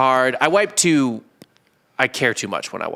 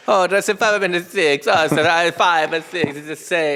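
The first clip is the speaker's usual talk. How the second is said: put-on Italian accent; 5 semitones higher than usual. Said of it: "so I'll just do Italian".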